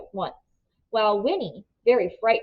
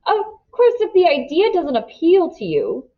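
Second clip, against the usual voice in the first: a touchy man stung by refusal; nervous petulance; 8 semitones higher than usual. A narrator's reading. Villain offended by the Tucks' refusal, nervous and petulant. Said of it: "a nervous petulance came into his voice".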